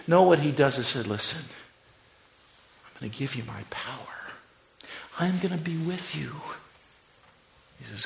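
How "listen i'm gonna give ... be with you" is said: tender Jesus. Acted. Jesus voiced gently to disciples.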